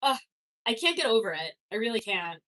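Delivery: usual speaking voice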